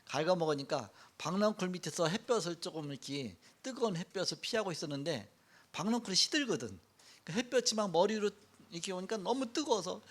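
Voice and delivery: normal talking voice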